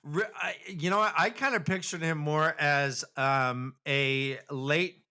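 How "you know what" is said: normal talking voice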